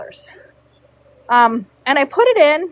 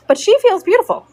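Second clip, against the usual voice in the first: mock proud client; 8 semitones higher, 4 dB louder, faster than usual.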